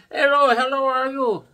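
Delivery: high, bright-voiced greeting from the clerk; high cheerful clerk greeting; imitating the clerk. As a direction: chirp the clerk's cheerful hello. Gas station clerk voiced by speaker.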